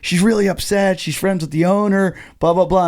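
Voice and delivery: imitating urgent caller. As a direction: press him insistently, as the worried go-between. Voices caller demanding the shoe back.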